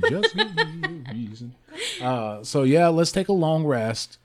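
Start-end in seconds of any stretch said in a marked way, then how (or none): 0.1-1.6 high, slow, helpless laughter through the words; laughing. Real, not acted. Cracking up while echoing the line.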